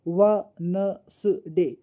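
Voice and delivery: वेडनेसडे read slowly as व न स डे, the D left silent; slow it down to व न स डे so the D is not heard; sounded out without D. Shown as a demonstration. Teacher to her class, showing the silent D by reading slowly. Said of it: "हमने क्या नहीं पढ़ा?".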